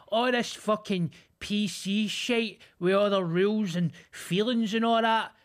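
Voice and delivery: hammed-up moaning about rules and feelings; mock old man rant. Mimicking the old sauna guy's PC rant, hammed-up.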